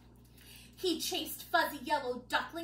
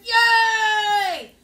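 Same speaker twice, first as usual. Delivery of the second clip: loud higher cheer; cheering yay; 6 semitones higher, 15 dB louder than usual. Acted. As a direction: cheer like the king. King cheers.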